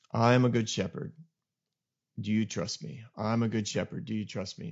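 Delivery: soft, low and tender, a hushed reassurance; gentle voice of God. Retold or imitated. Pastor recalls God speaking to him after the meeting, hushed and tender. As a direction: let it fall quietly, like a shepherd calming a sheep. God's voice heard in hard time.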